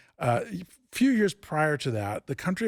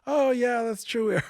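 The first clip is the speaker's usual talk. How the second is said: mock realization; 11 semitones higher, slower than usual. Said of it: "They gave me like".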